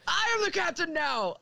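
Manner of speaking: high, playful echo of a film quote; mock captain takeover. Echoing the co-host's captain quote.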